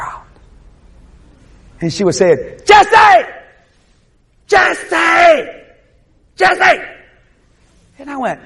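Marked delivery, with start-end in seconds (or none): 2.7-6.8 high, loud call from far off; hollering. Retold or imitated. Aunt calling from afar to her nephew in a vision, hollering. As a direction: holler the name across a great distance. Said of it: "She was hollering".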